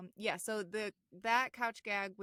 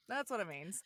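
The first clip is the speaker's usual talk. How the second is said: normal talking voice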